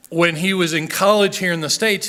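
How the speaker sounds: ordinary talk, nothing marked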